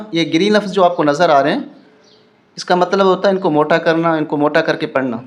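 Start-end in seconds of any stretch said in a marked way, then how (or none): none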